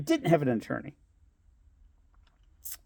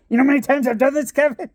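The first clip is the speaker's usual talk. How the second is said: higher and fast, seen-it-all; fast knowing boast; 9 semitones higher, faster than usual. Retold or imitated. Lawyer boasting to Kevin, quick and higher. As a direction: rattle it off like a veteran. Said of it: "He's like".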